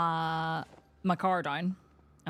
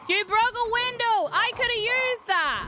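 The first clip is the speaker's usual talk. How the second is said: high, slow, loud outraged yell; outraged shout; 6 dB louder, slower than usual. Real, not acted. Player scolding the zombie who broke her window, outraged high yell. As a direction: yell at them for wrecking your stuff.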